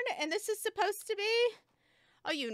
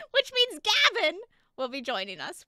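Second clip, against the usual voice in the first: ordinary delivery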